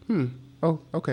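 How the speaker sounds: high, doubtful hmm then okay; a reluctant, unconvinced okay; unimpressed hmm. Retold or imitated. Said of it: "he was like".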